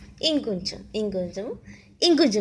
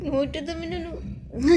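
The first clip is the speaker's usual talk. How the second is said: ordinary talk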